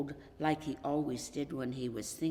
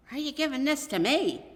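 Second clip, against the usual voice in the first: thrilled disbelief; 9 semitones higher, 7 dB louder, faster than usual. A narrator's reading. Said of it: "astonished and thrilled".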